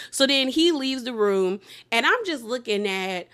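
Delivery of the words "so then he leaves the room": normal talking voice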